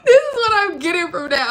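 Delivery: high, loud talk through real laughter; laughing. Real, not acted. Reader cracking up over the talking baby, laughing. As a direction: crack up and squeeze the words out.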